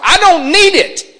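very high, loud laughing retort; defiant young David. Acted. Young David brushing off his brothers, very high and loud. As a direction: toss it off like a cocky kid.

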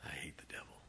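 hushed whisper of hatred. Real, not acted. Preacher to his church after the deathbed story, whispering. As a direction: breathe it out in a whisper.

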